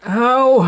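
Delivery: sharp high yelp of pain. Real, not acted. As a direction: cry out from sudden pain. Hurts himself on part.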